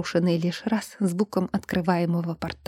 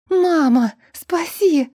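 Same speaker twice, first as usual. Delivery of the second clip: much higher, louder plea; screaming for help; 9 semitones higher, 4 dB louder, much slower than usual. A narrator's reading. Trapped girl screaming to her mother for help. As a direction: scream for mum to save you. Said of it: "начала орать, что есть мочи".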